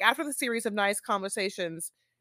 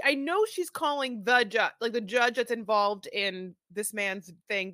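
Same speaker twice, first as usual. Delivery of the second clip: normal talking voice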